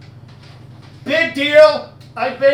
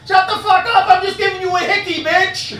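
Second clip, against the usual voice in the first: annoyed vampire buddy; 6 semitones higher than usual. Retold or imitated. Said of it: "He goes".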